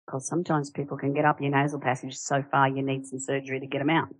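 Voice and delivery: ordinary talk, nothing marked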